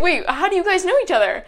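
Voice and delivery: high-pitched question through laughter; laughing, incredulous re-enactment; retold bewilderment. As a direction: act out baffled surprise while laughing. Retells her shock on walking in.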